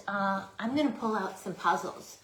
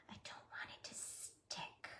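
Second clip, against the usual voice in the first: whispering; 19 dB quieter than usual. Acted. Repeats line in a whisper.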